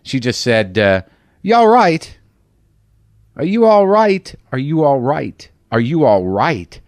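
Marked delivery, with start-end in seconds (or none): 1.4-6.8 high, loud British "You all right?"; imitating a British woman's worried check-in; fussy British concern, fading out; mock British concern. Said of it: "I don't know if this was British or what".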